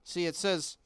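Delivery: usual speaking voice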